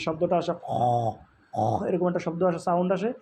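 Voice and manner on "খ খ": imitated long throat rasp "খ"; slaughtered cow's rasp. Shown as a demonstration. Teacher likens the letter to a slaughtered cow, rasping. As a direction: rasp air at the back of the throat. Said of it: "গরু জবাই করার পরে যে শব্দটা আসে".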